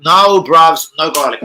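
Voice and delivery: loud, punched-out no; emphatic refusal. Acted. Customer refusing garlic on his salad, emphatic and loud.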